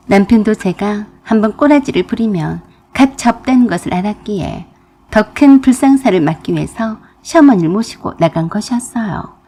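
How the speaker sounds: ordinary delivery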